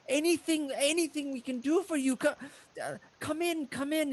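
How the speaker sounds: high, flustered stammer; grateful halfling. Acted. Halfling father thanking his rescuers, flustered gratitude. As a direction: gush thanks and usher them in.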